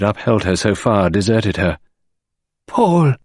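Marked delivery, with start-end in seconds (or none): 2.7-3.1 a wife's wail on seeing her dead husband; anguished cry. A narrator's reading. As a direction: cry the name out much higher and louder. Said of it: "she cried".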